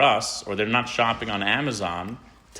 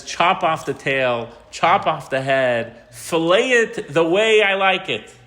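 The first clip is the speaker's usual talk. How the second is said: slow, louder royal order, rising high at the end; hammed-up haughty king; commanding king; 5 semitones higher, 6 dB louder, slower than usual. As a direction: bark orders at the chef. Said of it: "and he says".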